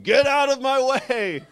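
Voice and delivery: put-on high, loud, slow old-man bellow; cranky old guy screaming his way past; old man yelling. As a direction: shove through the crowd hollering. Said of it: "he was yelling".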